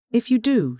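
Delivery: a mild narrated cry; cried out. A narrator's reading. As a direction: fling the threat at him. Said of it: "she cried".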